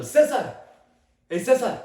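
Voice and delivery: high, loud call for attention. Acted.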